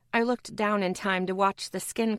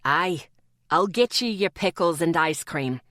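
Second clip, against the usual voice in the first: put-on Scottish accent; 4 semitones lower, 3 dB louder than usual. A narrator's reading. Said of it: "thick Scottish accent".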